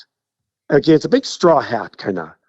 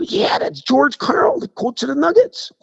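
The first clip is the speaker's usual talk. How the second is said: mock excited bystander; 11 semitones higher than usual. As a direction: imitate a star-struck bystander, pitched up. Retold awestruck bystanders confirming who it is.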